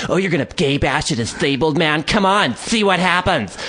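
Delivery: high, mocking dare; imitated taunting. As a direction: goad him in a put-on high voice. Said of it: "He noticed my hesitation. It's like".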